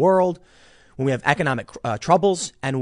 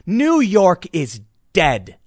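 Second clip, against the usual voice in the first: slow, louder, heavy final verdict; angry declaration; 4 semitones higher, 5 dB louder, much slower than usual. Real, not acted. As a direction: spell it out in disgust. Angry rant about memorial.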